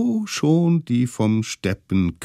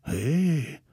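ordinary delivery, nothing marked

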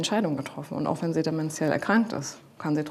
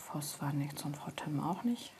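whispering; 12 dB quieter than usual. Real, not acted. Quiet handover in the morning.

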